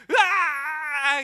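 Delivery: long, high, loud mock scream; terrified ride scream. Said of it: "saya begitu tegang, saya kayak gini".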